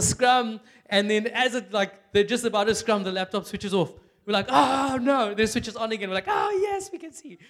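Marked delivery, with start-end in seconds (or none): 4.5-5.3 retold groan of dismay. Watching rugby, laptop dies. 6.3-7.4 high excited cry of relief that fades fast; a sudden high yelp of relief; retold joy. Telling the church how the room cheered when the laptop came back.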